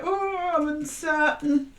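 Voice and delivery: high, slow, put-on unsure voice; mock uncertainty. Reader voicing Virgo's doubt about the new way, high and hesitant. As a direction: play it hesitant, drawn out. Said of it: "a little bit of like".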